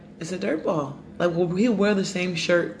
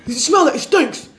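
higher, louder imitation of an upset husband; 6 semitones higher, 5 dB louder than usual. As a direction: retell his indignant complaint, pitched up and loud. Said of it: "my husband was very upset".

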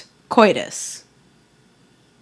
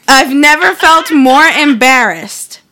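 slow, louder, weighted on "felt"; dramatic reading; 4 semitones higher, 6 dB louder than usual. Shown as a demonstration. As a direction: read it with mock melodrama. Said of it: "commas, people".

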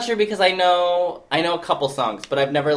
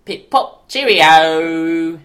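mock British accent; 7 dB louder, much slower than usual. Host spoofing British speech, hammed-up accent. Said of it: "let me do my British accent".

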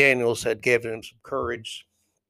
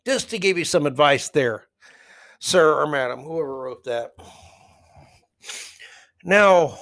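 ordinary talk

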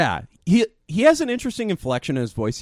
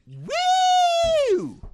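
long, high-pitched "woo"; sustained high woo; high whoop. Retold or imitated. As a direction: imitate an excited concertgoer's whoop. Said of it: "she went".

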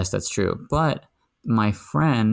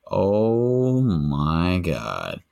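long drawn-out appalled reaction; much slower than usual. Retold or imitated. Housemate retelling her reaction to a shocking remark, appalled. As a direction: stretch every word out in disbelief.

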